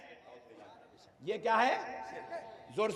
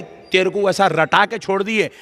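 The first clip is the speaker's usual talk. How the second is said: ordinary delivery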